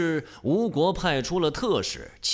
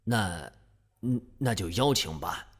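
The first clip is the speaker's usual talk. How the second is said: reluctant, glum; 6 semitones lower, 4 dB quieter than usual. Acted. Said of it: "鲁哀公哭丧着脸".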